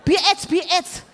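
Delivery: high, rowdy classroom chant; mock chorus of cheeky pupils; imitating pupils chiming in. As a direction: call it out like heckling kids. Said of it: "Langsung yang lain nyaut".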